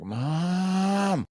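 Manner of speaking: put-on seven-year-old whining for mom; whiny kid calling. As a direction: call for mom in a whiny child's voice. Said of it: "like, doing that thing".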